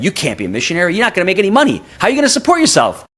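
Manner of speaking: alarmed disbelief. Retold or imitated. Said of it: "the old Gino would have said".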